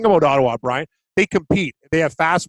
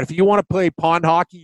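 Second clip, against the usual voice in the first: ordinary talk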